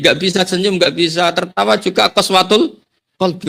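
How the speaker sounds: normal talking voice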